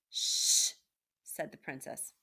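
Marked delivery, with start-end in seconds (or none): 0.1-0.7 long, forceful hushing sound; loud drawn-out "shh"; loud shush. A narrator's reading. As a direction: hush her sharply. Princess hushes Meg.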